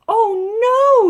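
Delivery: high, loud dramatic wail; mother's yell. A narrator's reading. Mother finds the car won't start, high loud yell. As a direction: cry out loud with a long, high no. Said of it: "yelled Loretta's mother".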